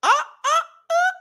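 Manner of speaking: very high, slow stunned uh uh uh; shocked stammer. Retold or imitated. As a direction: gape at it in disbelief. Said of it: "I'm like".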